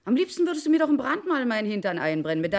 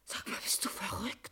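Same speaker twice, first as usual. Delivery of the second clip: breathless whisper; 3 semitones lower, 15 dB quieter than usual. Acted. Gasping after the attack.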